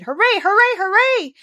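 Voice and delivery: high, loud cheering shout; shouting hooray. Acted. Healthy boy cheering at the garden news, shouting. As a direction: cheer it out three times. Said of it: "Colin would probably have shouted".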